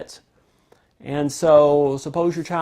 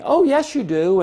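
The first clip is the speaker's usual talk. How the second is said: eager reassurance; 10 semitones higher, 5 dB louder than usual. Retold or imitated. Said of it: "we start saying".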